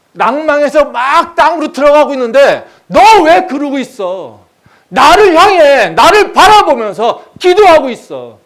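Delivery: shouted, high-pitched sermon exhortation; impassioned pulpit roar; full-force preaching. Acted. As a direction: drive every phrase at the congregation. Preaches at full force, voicing God's command.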